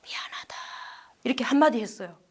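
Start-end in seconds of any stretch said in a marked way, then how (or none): 0.0-0.5 a breathy near-silent whisper of 미안하다; barely audible. Retold or imitated. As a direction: let 미안하다 slip out with almost no voice. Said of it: "다 주고 가는 목소리로". (아들에게 겨우 꺼내는, 숨소리만 남은 속삭임)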